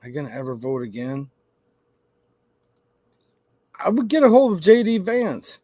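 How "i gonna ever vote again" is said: mock deflated voter. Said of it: "kinda like".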